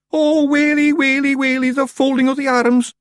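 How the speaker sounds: very high, loud keening wail; keen like mourners; mock lament. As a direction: Feagles crying out in mock despair. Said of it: "the Feagles cried".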